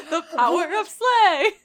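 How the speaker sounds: very loud, slow hero catchphrase; Winston from Overwatch. Acted. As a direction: proclaim it high and big, half laughing. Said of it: "So we just keep saying".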